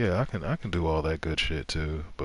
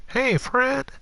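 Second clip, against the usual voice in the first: much higher, louder mocking nasally greeting; mocking impersonation of an overly friendly manager; nasally manager impression; 7 dB louder than usual. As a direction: mimic the assistant manager's nasal chirp. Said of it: "I mean, like".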